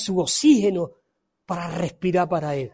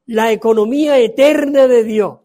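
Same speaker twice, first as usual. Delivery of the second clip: preaching at full force; 5 semitones higher, 8 dB louder than usual. Acted. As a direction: proclaim it from the pulpit. Preaching about Hannah's prayer. (predicación a todo pulmón)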